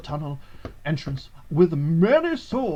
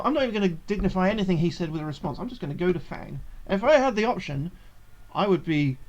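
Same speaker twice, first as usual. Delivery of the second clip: ordinary delivery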